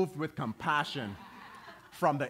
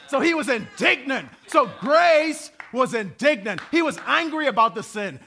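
loud, high sermon climax; fiery gospel preaching; preaching at full force; 10 semitones higher, 12 dB louder than usual. Real, not acted. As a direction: lift the room, preacher in full cry.

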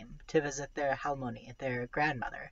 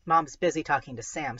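ordinary delivery

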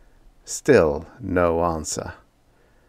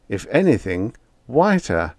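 ordinary delivery

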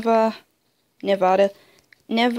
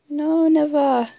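usual speaking voice

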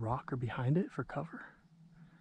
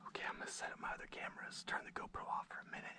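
fast whisper; 15 dB quieter, faster than usual. Real, not acted. Whispering setup.

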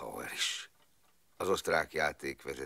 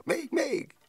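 even higher, louder squeal of "Még, még!"; a louder child's squeal of "Még, még!"; gleeful child shout; 8 dB louder than usual. Retold or imitated. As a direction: shriek "Még, még!" with full childish delight. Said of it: "kiáltotta".

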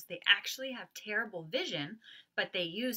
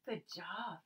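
laughing praise; 9 dB quieter than usual. Real, not acted.

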